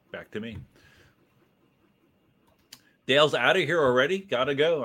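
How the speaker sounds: ordinary talk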